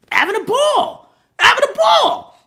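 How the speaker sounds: very high, incredulous squeal; high, hammed-up outburst; mock exclaiming. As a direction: pitch it way up in exasperation. Mock outrage at love scene.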